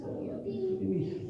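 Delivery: soft, high-pitched mock sobbing plea; pretend crying. Shown as a demonstration. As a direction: beg as if in tears, thin and hushed. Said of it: "pretend like you're crying".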